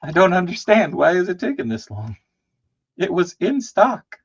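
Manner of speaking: high, whiny complaint; mock whine. Lightly hammed-up whine.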